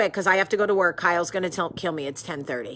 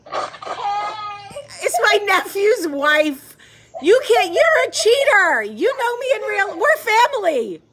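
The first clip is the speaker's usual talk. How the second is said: high, loud, slow squeal of surprise, half crying; excited shriek; 9 semitones higher, 5 dB louder, slower than usual. Real, not acted. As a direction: shriek with delighted disbelief. Surprised to see nephew's wife online.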